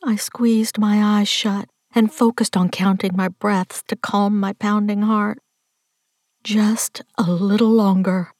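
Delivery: ordinary delivery, nothing marked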